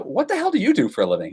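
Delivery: high, incredulous retold outburst; baffled question. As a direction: ask it like you finally can't stand not knowing. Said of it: "And I go".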